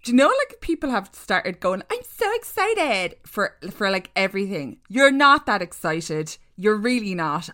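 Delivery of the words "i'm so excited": high-pitched imitation of empty enthusiasm; mock excitement. Mocking people who say it for everything, affected excitement. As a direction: mimic people who overuse the phrase. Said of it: "bland, like, monotone voice".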